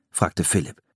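ordinary delivery, nothing marked

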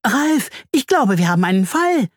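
high-pitched excited little voice; excited squeal of a storybook bee. A narrator's reading. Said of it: "summt sie aufgeregt".

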